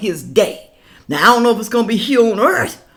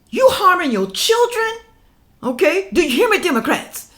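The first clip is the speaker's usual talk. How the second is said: scolding; 8 semitones higher than usual. Real, not acted. Scolding Democrats.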